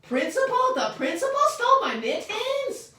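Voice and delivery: very high, loud shocked question. Acted. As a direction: gasp out the word in shock. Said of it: "very shocked".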